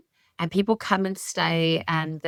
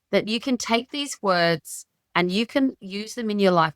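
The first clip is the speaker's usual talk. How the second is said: normal talking voice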